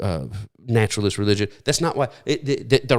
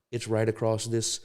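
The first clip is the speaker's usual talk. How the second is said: ordinary delivery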